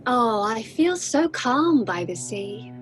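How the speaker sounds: ordinary delivery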